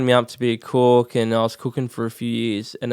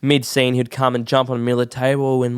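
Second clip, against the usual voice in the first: normal talking voice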